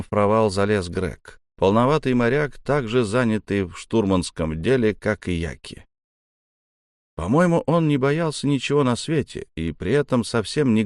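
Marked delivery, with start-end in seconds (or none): none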